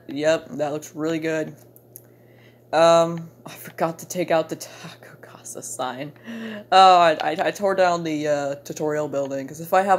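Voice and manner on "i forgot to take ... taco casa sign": whispered aside to self; breathy under-the-breath whisper. Real, not acted. Mutters a sudden realisation aside.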